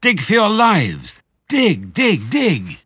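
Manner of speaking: high-pitched, drawn-out frantic yell; urgent cry. Acted. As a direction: cry out to dig for dear life. Fox urges family to dig.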